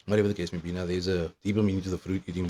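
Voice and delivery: ordinary delivery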